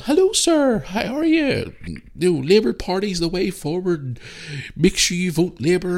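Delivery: high, sweet mock young woman's voice; imitating a girl pushing Labour in falsetto; girl canvasser.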